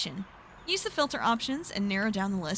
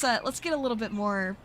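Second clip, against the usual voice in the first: ordinary delivery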